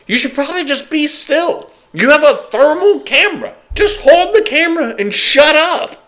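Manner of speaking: high, pointed scolding; exasperated scolding. Retold or imitated. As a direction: tell them off in disbelief. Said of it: "I'm like".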